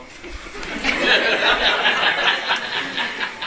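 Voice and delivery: a real, breathy burst of laughter; short amused laugh. Real, not acted. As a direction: laugh it off at the awkward small talk. Laughs at own mock greeting.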